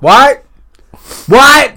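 high, loud, jolted awake; mock startled shout. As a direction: bark it like someone hard of hearing. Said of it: "you'll be yelling at him".

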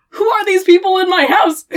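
high, loud comic disbelief; indignant high-pitched mock yell; mock outrage. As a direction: protest as if invaded, hammed up. Voicing Evan's outrage at intruders.

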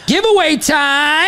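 drawn out and high, like a game show host; giveaway time shout-out; hype announcement. Acted. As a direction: announce it big and slow.

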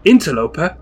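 loud, outraged echo of the word; villain thundering in anger. Acted. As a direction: bellow it back at the cat. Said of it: "Randolph thunders with anger".

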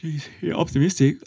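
spoken through a yawn. Real, not acted. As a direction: yawn the words out. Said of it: "sorry, you just yawned".

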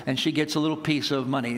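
usual speaking voice

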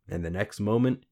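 ordinary delivery

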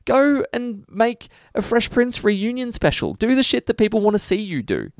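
high, heated ranting; real annoyed rant. Real, not acted. Ranting to a friend about Will Smith, heated and high. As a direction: vent about the actor, pitch climbing high. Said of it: "You're so annoyed right now".

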